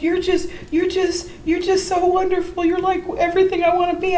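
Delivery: high, gushing, admiring voice; a girl overwhelmed by her hero; adoring teen girl. Retold or imitated. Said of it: "she said".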